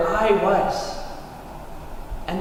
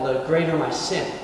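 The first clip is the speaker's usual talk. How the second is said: usual speaking voice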